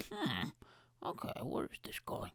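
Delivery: murmured, then whispered; hushed aside. Retold or imitated. Boy hushes his surprise at mom appearing, murmured then whispered. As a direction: whisper the thought under your breath. Hushed inner thought on mom's arrival.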